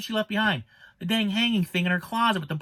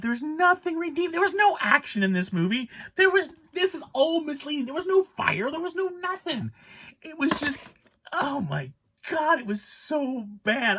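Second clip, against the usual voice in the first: exasperated rant; 8 semitones higher than usual. Real, not acted.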